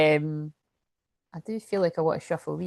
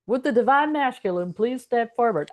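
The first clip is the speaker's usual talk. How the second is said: mock summons; 5 semitones higher, 3 dB louder than usual.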